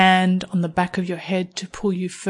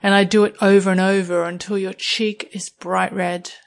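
ordinary delivery

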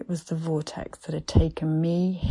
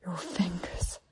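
breathless whisper rising louder; 6 dB louder than usual. A narrator's reading. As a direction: gasp it out, out of breath. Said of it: "I said breathlessly".

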